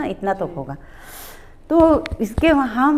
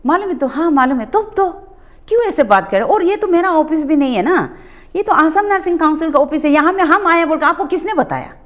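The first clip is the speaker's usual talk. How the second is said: raised, higher-pitched angry questioning; angry confrontation; 6 semitones higher than usual. Retold or imitated. Confronting the stranger posing as advocate, angrily. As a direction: demand answers, indignant and sharp. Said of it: "तो हम थोड़ा गुस्सा हो गया".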